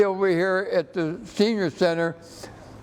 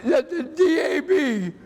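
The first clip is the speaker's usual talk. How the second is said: choked up; 6 semitones higher than usual. Real, not acted. Veteran thanking the group that cuts his grass, choking up with laughing tears. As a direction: voice breaking into a laughing sob. Grateful veteran overcome by kindness.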